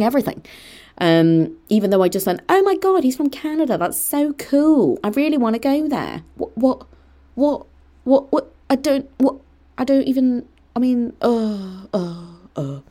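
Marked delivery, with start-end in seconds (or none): 2.5-6.2 high-pitched, re-enacted innocent gushing; gushing excitement. Re-enacting an admiring remark about the professor's accent, gushingly. 6.3-12.8 high, halting splutter, lost for words; baffled stammering. Real, not acted.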